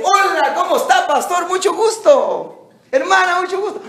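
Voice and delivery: high, bright-pitched happy hello with a laugh; delighted greeting. Retold or imitated. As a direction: greet someone you suddenly recognize, thrilled. Member greets pastor, recognizing voice.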